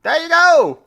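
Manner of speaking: high, hammed-up London accent; attempted London accent. Host tries a London accent for the listeners, hammed up. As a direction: try out a cheeky London accent. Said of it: "I'm trying to think of a London accent".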